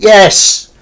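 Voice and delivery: loud, high burst of "yes"; triumphant cheer. Real, not acted. Cheering a viewer's reply.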